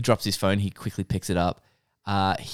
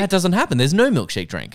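louder, higher contradiction; exasperated objection; 10 semitones higher, 6 dB louder than usual. Real, not acted. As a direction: shut down the others' version loudly. Arguing over the retold plot.

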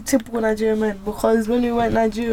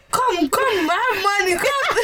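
mocking market man; 7 semitones higher, slower than usual. Mocking market men calling out.

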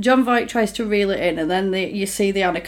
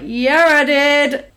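smug villain; 5 semitones higher, 4 dB louder, much slower than usual. Retold or imitated. Villain voiced confessing.

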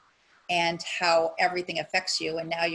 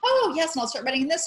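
mock eager delight; 8 semitones higher, faster than usual.

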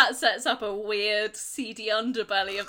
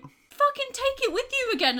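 high, incredulous outrage; can't believe it, exasperated; 14 semitones higher than usual. Real, not acted. Exasperated rant about plot.

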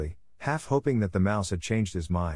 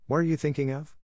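mildly severe, pitched up; a touch of stern reproof, raised pitch; 6 semitones higher than usual. A narrator's reading. Mouse scolds Alice for not attending, mildly severe. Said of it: "to Alice severely".

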